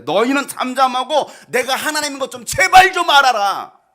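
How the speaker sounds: high, very loud scolding; exasperated shout. Acted. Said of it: "소리치고 싶으셨을지도 몰라요". (답답한 듯 크게 소리치며)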